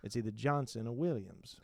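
a hint of a wounded buddy; lightly voiced Justin. Justin pleads with Johnny about his name, lightly voiced.

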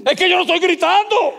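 high, strained protest; mock yelling denial. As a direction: insist you aren't yelling while sounding like it. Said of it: "¿Tú has oído a gente diciendo como yo?".

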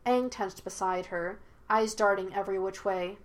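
usual speaking voice

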